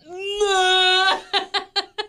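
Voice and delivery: a high, laughing, strangled yelp; strangled dream scream. Retold or imitated. Mom imitating her sleep scream to her co-host, laughing strangled yelp. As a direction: imitate a scream that won't come out. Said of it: "but it came out like".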